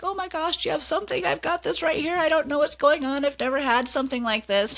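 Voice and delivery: high-pitched, rushed worry, imitating a coworker; mock-alarmed, pitched well up; retold fretting. Retold coworker panicking over new sore.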